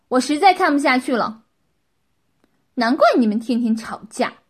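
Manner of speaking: loud, outraged telling-off; indignant scolding. A narrator's reading. Qin scolds the couple for their shamelessness, loud and indignant. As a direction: berate them at full volume. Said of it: "莫名气愤地骂道".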